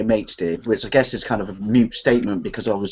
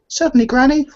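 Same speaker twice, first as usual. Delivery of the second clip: put-on eager child; 4 dB louder than usual. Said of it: "the joke you used to say".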